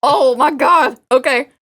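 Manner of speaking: normal talking voice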